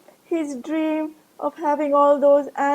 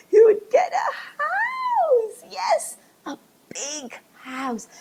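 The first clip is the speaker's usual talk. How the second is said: much higher, loud and slow, laughing; ecstatic shout; 12 semitones higher, 4 dB louder, slower than usual. Acted. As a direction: cry out in wild joy. Ramu's ecstatic dream climax.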